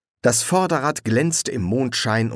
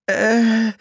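high, drawn-out dazed hesitation; dazed and confused; 13 semitones higher, 5 dB louder than usual. A narrator's reading. Stranger in the ditch loses the thread, dazed and confused. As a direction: trail a long, bewildered filler sound. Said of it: "die Stimme klang nun benommen und verwirrt".

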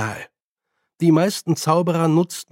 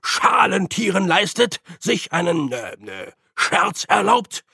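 empört; 4 semitones higher than usual. Acted. Rektor empört über Scherz.

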